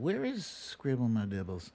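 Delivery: usual speaking voice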